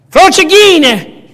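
Frocighine boomed slow and high, rolled Italian vowels; mocking the Pope's Italian word, slow and loud; hammed-up Italian word. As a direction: belt out Frocighine like an Italian outburst.

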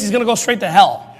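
usual speaking voice

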